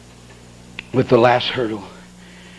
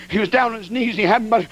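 ordinary talk, nothing marked

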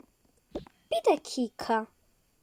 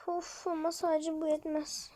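normal talking voice

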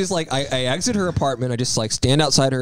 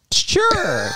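a sudden high squeaky sure, played for laughs; mock eager yes. Telling friends how he answered his date, mock eager yes. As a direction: blurt it out way up high like a cartoon. Agreeing to girlfriend's odd request.

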